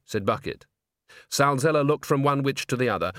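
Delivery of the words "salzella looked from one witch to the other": ordinary delivery, nothing marked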